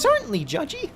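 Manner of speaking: sing-song it like a cartoon; playful mock nickname; silly high tease. Teasing silly voice.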